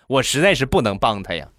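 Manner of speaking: ordinary talk, nothing marked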